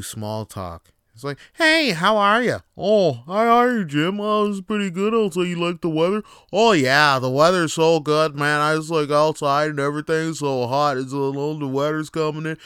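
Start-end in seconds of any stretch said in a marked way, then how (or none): 1.5-2.6 higher, louder chirpy greeting; mock a cheery neighbour's hello; imitating a cheery small-talker. Acting out small talk. 2.8-12.6 imitating chatty neighbours; mock small talk chatter.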